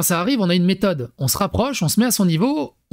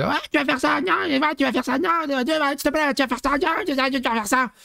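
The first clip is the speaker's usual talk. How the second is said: much higher mock nagging voice; mocking wife's nagging; 8 semitones higher than usual. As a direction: imitate the wife nagging her daughter, pitched up. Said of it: "des fois, ils s'engueulent". (imitation de la femme qui houspille sa fille, voix aiguë)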